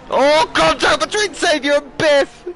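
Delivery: high, loud, drawn-out crash call; excited shout. Real, not acted. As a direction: shout the collision like a race commentator. Commentator calls a collision live.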